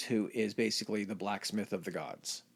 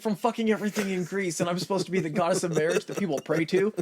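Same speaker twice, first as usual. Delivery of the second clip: much higher and louder, indignant goddess; mock Hera rant; 9 semitones higher, 5 dB louder than usual. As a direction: rant like a fed-up wife. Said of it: "She's like".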